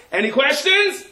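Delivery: high, loud barked question; commander's call. Acted. Commander asking his soldiers, loud and high. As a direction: call out to the troops.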